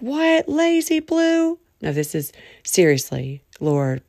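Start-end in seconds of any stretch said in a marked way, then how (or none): 0.0-1.6 much higher, slowed disbelief; high incredulous mock; mock surprise. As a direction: act shocked at yourself.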